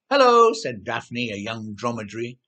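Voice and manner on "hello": much higher and louder bright hello; cheery camel greeting. A narrator's reading. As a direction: call out a big friendly hello. Young dromedary greets suddenly.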